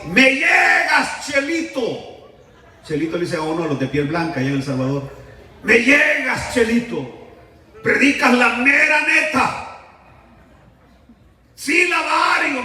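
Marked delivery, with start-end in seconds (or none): 0.1-2.3 high, drawn-out heckling yell; imitated shout, stretched slowly; drunken heckler's shout. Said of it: "ese hombre gritaba y decía así". 5.6-12.6 high-pitched, stretched-out holler; imitated yell, long vowels; drunken heckler's shout. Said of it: "ese hombre gritaba y decía así".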